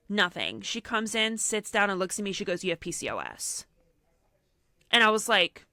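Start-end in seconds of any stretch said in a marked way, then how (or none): 2.6-3.6 imitated blunt doctor, low and soft; blunt diagnosis. Doctor to patient straight after sitting down, imitated blunt delivery. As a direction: drop it low, with no greeting in it.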